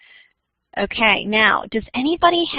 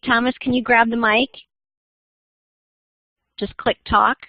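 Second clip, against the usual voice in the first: normal talking voice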